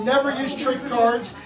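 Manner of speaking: ordinary talk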